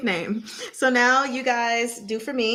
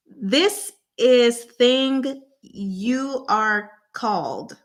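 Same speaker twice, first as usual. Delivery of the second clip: slow clue; 3 semitones higher, much slower than usual. Real, not acted. Slowly spelling out a clue.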